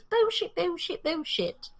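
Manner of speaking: high mock British accent, the word drawn out and slow; Kristen Bell's Russell Brand. Quoting her favorite movie bit to friends, high mock British accent. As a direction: bounce a cheeky English accent up high. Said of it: "Kristen Bell imitating Russell".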